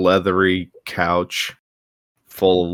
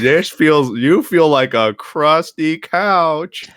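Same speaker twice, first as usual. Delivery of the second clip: drawn-out, higher comic grumble; mock complaint; 6 semitones higher, 4 dB louder, much slower than usual. Recounting sitting on his co-host, drawn-out comic grumble. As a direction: stretch the words in exaggerated complaint. Quoting own reaction while sitting.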